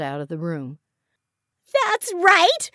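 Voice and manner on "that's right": high, furious shriek; screaming. A narrator's reading. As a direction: scream it after them in a rage. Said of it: "Iris screamed".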